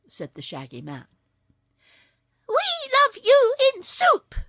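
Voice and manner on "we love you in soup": high, loud creature chorus; piercing high-pitched shout; shrill yell. A narrator's reading. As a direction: shriek it with hungry menace. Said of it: "they yelled".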